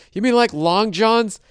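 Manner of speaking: high, slow mock questioning voice; imitated store clerk. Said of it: "Everybody I talk to talk like that".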